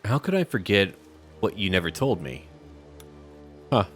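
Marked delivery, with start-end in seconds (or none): none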